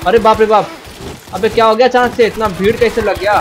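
high, loud burst of surprise; alarmed shout. Real, not acted. Gamer swamped by a sudden crowd, alarmed shout.

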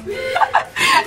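quick high-pitched giggle; real giggle. Real, not acted. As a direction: giggle at the joke. Laughing at own joke.

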